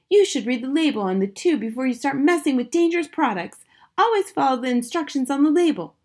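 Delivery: high, loud, know-it-all wife; scolding wife. A narrator's reading. Wife to husband about the superglue, scolding.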